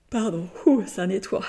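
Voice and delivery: words stretched by an escaping yawn. Real, not acted. Healer apologising as the cleansing makes them yawn, yawning. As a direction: talk through a real yawn, pitch lifting. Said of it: "ça me fait bâiller".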